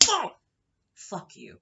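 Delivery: sudden high, loud yell; acted explosive curse; exploding. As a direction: burst out with one sharp shout. Acted outburst at the man.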